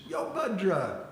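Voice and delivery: hearty acted hello; hailing greeting. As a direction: hail a buddy with gusto. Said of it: "Krishna greeted him".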